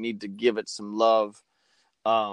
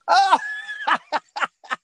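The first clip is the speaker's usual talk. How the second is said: high, shouted burst of laughter; howling laugh; loud laugh; 5 dB louder than usual. Real, not acted. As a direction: crack up loudly. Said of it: "Oh, my God".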